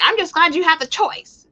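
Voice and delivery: high, loud mock-cheery put-on voice; mocking commenter. Retelling pro-choice comments on a story, high mocking voice.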